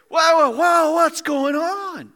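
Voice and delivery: high, loud bewildered cry; comic panic at being lifted; mock alarm. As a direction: stretch it out in startled disbelief. Acts out bewildered lame man.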